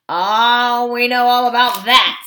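knowing dwarf scoff; sneering dwarf. A narrator's reading. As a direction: brush it off sarcastically.

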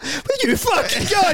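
mock-furious high-pitched shout; outraged yell. Fan at the game yelling at someone squeezing past, mock outrage. Acting out his match-day outburst.

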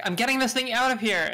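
loud, high panicked shout; panicked yell. Retold or imitated. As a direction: yell it as you flee. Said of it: "It's like".

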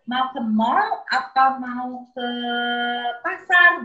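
put-on drawn-out choice for a child; slow asking child. Mother slowly asking her son where to go.

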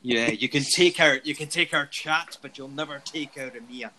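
rousing Scottish battle cry, lightly done; hammed-up Braveheart Scottish speech; Braveheart Scottish accent. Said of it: "with this beautiful Braveheart Scottish voice".

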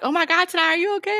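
high-pitched mock concern, climbing; retold alarm, pitched high; alarmed onlookers. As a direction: imitate everybody fussing over you. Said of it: "everybody was like".

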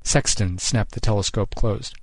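usual speaking voice

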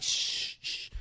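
two unvoiced shh, fading out; hushing shh. Teacher hushing the saluting students. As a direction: shush them quietly. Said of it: "And she's like".

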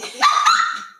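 squealing high laugh; high-pitched laugh. Real, not acted. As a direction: let out a shrill whoop of laughter. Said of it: "that high-pitched part is like, whoo".

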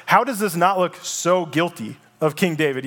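ordinary talk, nothing marked

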